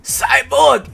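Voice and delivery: high, loud shout of the name. Acted. Cyborg shouting at the passing jet, loud and high. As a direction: yell it to get attention.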